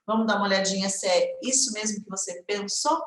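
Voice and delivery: normal talking voice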